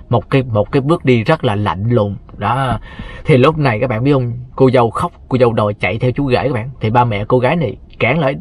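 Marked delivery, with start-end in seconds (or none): none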